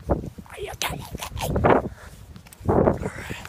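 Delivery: hushed unvoiced whisper. Real, not acted. Vlogger mutters to the camera in a whisper while people pass. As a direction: breathe the words under your breath.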